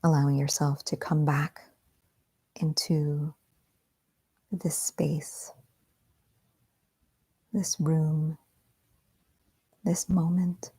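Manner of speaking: soft, whispery meditation guide; guided meditation. Acted. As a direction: ease them gently back into the room.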